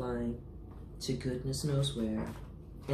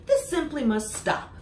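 higher, louder stern shout; mouse shouting; 13 semitones higher, 6 dB louder than usual. Acted. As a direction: demand it firmly, loud. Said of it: "sat up and shouted".

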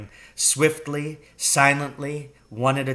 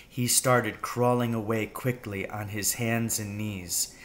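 ordinary delivery, nothing marked

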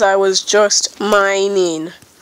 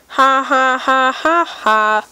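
higher, forced ha-ha laugh; fake laugh; 4 semitones higher than usual. Player mocking their own mistake, fake laugh. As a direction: laugh artificially, each "ha" spelled out.

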